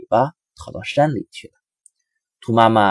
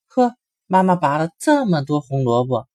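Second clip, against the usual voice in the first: delighted; 6 semitones higher, 5 dB louder than usual. A narrator's reading. Bunnies delighted by carrots.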